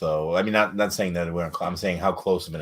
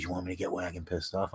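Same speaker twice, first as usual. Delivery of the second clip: conspiratorial aside; 10 dB quieter, faster than usual. Retold or imitated. Friend leaning over to plot teasing Wagon, hushed aside. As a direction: imitate a hushed, hurried offer. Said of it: "he leans over to me".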